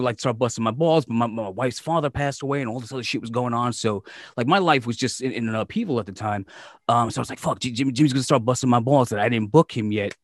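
ordinary delivery

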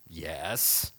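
usual speaking voice

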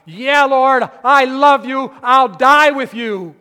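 loud, high, emphatic vow, drawn out; proud declaration. Acted. Preacher voices Peter's old boastful vow to Christ, proud. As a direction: declare it boldly at full volume, full of pride. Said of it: "No longer is that pride".

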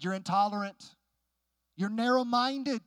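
mock outrage, stretching the last charge upward; retold accusations. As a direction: imitate an accuser piling on charges. Seatmate piles on retold accusations.

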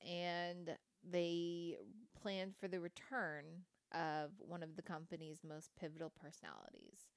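ordinary delivery

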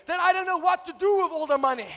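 loud, driving preacher's call; full-force preaching. Acted. Preacher rousing the church about blessing, loud and fervent. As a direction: preach it hard to the congregation.

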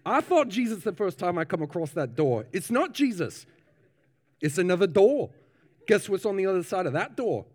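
high-pitched New Zealand minister; New Zealand accent. Acted. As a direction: correct the congregation in a put-on New Zealand accent. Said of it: "And the minister says".

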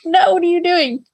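high-pitched startled telling-off; retold alarmed scolding. Owner to her pet about to jump off the table, high retold alarm. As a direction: reenact a mock-panicked "stop that".

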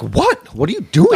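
high-pitched mock-startled question; shocked wife. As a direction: imitate a wife walking in, baffled. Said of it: "Did your wife come into the room and they're like".

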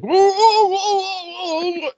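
mutant whoa noise. Retold or imitated. Friend imitates Biocop's funny noises, high drawn-out whoa. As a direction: wail like a dazed mutant. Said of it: "makes the funniest noises every time".

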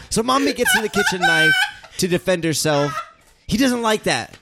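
Heath Ledger Joker. Acted. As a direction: cackle through it like a manic clown. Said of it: "I am Heath Ledger".

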